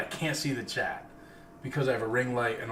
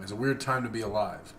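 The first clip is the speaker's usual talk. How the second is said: normal talking voice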